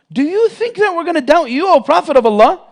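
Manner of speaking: astonished protest. Retold or imitated. Companions protest in disbelief.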